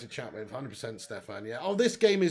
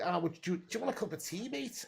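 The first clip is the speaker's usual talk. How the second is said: Sir Alex in Scouse; 3 semitones higher, 7 dB quieter than usual. Pretend. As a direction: slip into a Scouse accent by mistake. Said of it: "why have I done Scouse?".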